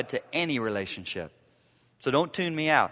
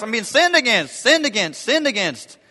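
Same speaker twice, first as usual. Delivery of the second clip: higher, mocking the wounded spouse; higher mock-whine of a hurt spouse; whiny self-pity; 5 semitones higher, 4 dB louder than usual. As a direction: parody someone harping on being wronged. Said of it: "That's your emphasis".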